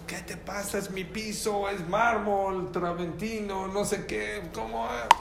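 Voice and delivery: half-voiced imitation of a scolding; retold mock yell. Rich man to poor visitor over dirty boots, mock yelling. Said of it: "le empezó a gritar al rico".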